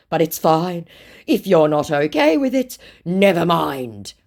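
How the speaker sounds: guilt-trip as the scammer, slowing at the end; emotional blackmail. Retold or imitated. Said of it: "straight in for the emotional blackmail".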